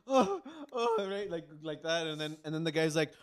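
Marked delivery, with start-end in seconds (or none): 0.1-1.1 high pained oh-oh while limping; pained groans. Retold or imitated. Showing his friend how he limped, pained high groans. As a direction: groan as if walking on a bad ankle. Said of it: "I'm just like".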